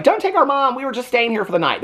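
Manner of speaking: put-on high, pleading kid voice. Kids begging police to spare their mom, high and pleading. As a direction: beg the police not to take mom.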